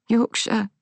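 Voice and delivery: high, tearful answer. A narrator's reading. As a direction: answer while fighting back tears. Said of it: "wiping away tears".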